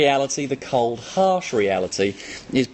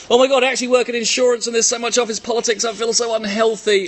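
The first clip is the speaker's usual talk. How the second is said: high, quick whiny office worker; mock viewer voice; 10 semitones higher than usual. Trainer voicing a viewer's comment, high and quick. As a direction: rattle it off like a stressed office worker.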